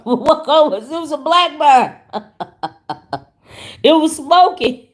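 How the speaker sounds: cracking up while joking back; laughing. Real, not acted. Teasing a viewer, laughing.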